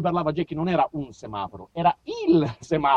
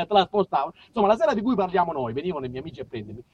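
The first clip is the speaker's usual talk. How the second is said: ordinary talk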